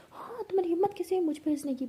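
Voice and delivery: high-pitched mock outrage; indignant outburst. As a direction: challenge them, voice shooting up. हँसी पर बुरा मान कर. (तुनक कर, ऊँची आवाज़ में)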